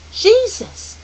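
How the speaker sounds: put-on high, loud child's exclamation; excited realization. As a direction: burst out with the answer. Charles excitedly guessing the answer.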